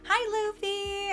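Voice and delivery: ordinary talk, nothing marked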